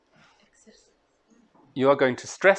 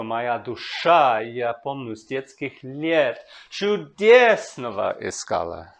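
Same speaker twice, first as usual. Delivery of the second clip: slow reading that lands on the main phrase stresses; slow Russian reading with phrase stress; 3 semitones lower, slower than usual. Shown as a demonstration. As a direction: read slowly, hitting the main stress of each phrase. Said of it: "I've also marked the main stress".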